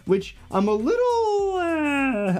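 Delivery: high whiny fake cry; mock whimper. Mock whine about farming.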